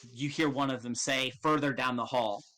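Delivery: ordinary talk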